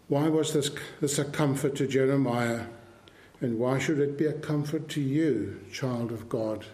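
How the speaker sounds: ordinary talk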